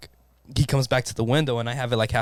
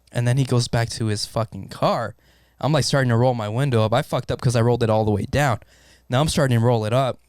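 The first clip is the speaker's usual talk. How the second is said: normal talking voice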